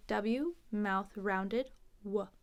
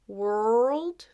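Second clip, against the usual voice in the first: "world" stretched long, rising and louder; dragged out; 5 dB louder than usual. Shown as a demonstration. Teacher compares world and whirl, "world" drawn out. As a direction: drag out the r in "world". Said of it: "If we drag it out".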